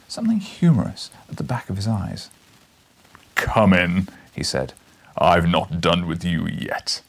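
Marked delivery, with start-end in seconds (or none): 3.3-7.0 low, slow menacing professor; sinister booming Challenger. Acted. Challenger summoning the reporter back inside, low and sinister. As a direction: invite him in with a threat.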